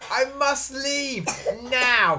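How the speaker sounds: high, loud, slow declaration; alarmed resolve. A narrator's reading. Trembling Jack deciding to flee, alarmed. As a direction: stress the urgency word by word. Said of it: "Jack trembled and shook".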